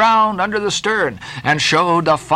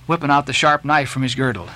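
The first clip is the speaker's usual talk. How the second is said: usual speaking voice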